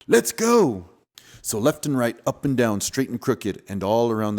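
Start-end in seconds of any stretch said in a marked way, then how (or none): 0.1-0.9 eager cry. Acted.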